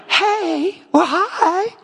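high, flirty mock greeting; high-pitched coy hello with a laugh; delighted ladies' reply. Imitates delighted older ladies greeted.

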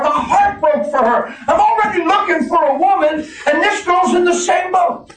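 high, loud preacher's cry with laughs; full-force preaching. Acted. Pastor telling the church about the girl, preaching at full force. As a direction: preach it out to the rafters.

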